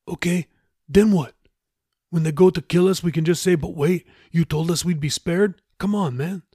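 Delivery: a touch of incredulous shipmate; lightly voiced Raoul, skeptical.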